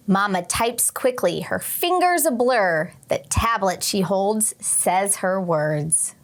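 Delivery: gentle read-aloud storytelling voice; story narration. A narrator's reading. Teacher reading a picture book to her class, gentle storytelling.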